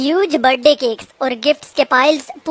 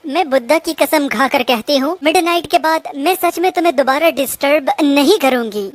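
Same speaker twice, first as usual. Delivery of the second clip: ordinary delivery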